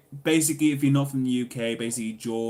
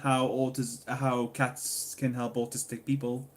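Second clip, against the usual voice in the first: usual speaking voice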